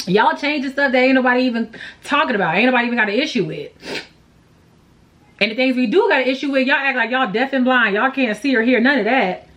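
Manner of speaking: rapid, exasperated complaint; fast rant. Real, not acted. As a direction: rattle off the grievances at speed. Ranting at the brand.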